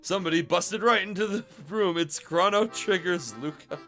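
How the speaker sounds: usual speaking voice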